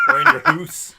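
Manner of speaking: high laughing Northern English "hoose"; Northern English accent. Retold or imitated. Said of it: "accent from Manchester".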